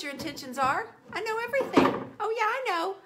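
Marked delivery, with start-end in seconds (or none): 1.1-3.0 high, loud playful boast; mock know-it-all. Teasing viewers that she knows everything, hammed-up. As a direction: brag like you know it all.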